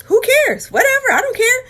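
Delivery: high, flippant brush-off; imitating her old careless self; dismissive past self. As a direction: wave it all away, mocking. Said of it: "I had that wall up".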